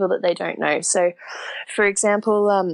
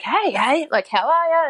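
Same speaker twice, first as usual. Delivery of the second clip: higher-pitched, lightly acted eager hello; friendly greeting; 9 semitones higher than usual. Friendly local greeting a newly arrived rider, lightly eager. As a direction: imitate a keen stranger saying hello.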